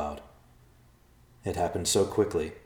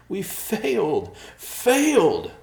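loud anguished wail; despairing shout; 14 semitones higher, 7 dB louder, much slower than usual. Acted. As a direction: cry out in despair. Thomas in despair.

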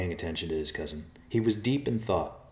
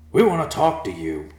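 loud, higher call upward; 8 semitones higher, 10 dB louder than usual. Acted. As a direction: shout so the bird hears. Bear calling up to vulture.

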